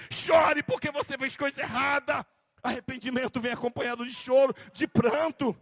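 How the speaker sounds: loud, forceful preacher's exhortation; fiery preaching. Acted. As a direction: drive the call to weep home. (pregação inflamada e forte)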